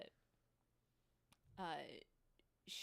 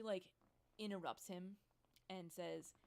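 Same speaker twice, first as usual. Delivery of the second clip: usual speaking voice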